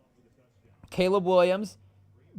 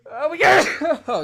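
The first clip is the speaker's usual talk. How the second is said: sneeze; 13 semitones higher, 12 dB louder than usual. Real, not acted. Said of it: "excuse me, chat".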